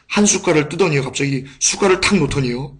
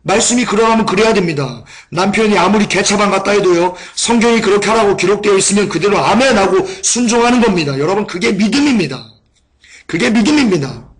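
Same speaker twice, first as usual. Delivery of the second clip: preaching; 4 semitones higher than usual. Acted. Preacher exhorting the congregation.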